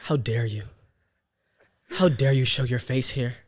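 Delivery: dramatic reproach, pausing between accusations; acted indignation. Teacher confronting the student who tormented her, acted indignation. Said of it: "I'm about to get in my acting bag".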